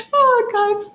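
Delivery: very high, sing-song cooing. Real, not acted. Greeting her puppy Gus on camera, high cooing baby talk. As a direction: squeal sweetly at the puppy.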